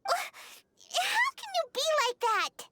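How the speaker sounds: high, slow, crying protest; tearful plea. Acted. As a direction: voice breaking as she pleads with a friend. Paimon upset at Zhongli's silence.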